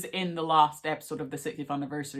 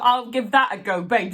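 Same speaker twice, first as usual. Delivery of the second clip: high, louder, breezy mock-Doctor; cheeky Doctor; 7 semitones higher, 9 dB louder than usual. Quoting the Doctor's throwaway line, cheeky and bright. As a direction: toss it off like a cocky hero. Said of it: "You thought".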